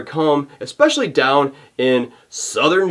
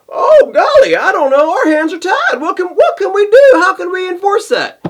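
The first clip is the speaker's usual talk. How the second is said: much higher, louder, laughing mock whine; mock helpless official; 7 dB louder than usual. Vlogger mocks officials pretending their hands are tied, high hammed-up whine. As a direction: ham up the fake hand-wringing, high and loud with a laugh.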